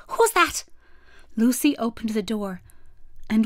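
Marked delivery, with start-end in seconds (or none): 0.1-0.6 high, startled little voice; frightened call. A narrator's reading. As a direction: call out in alarm, pitched up high. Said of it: "A little frightened voice called out".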